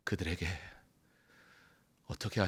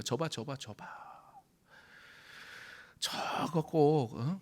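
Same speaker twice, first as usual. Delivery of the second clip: mock gossip; 5 semitones higher than usual. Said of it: "이러면서 사람들이 욕하지 않았겠습니까".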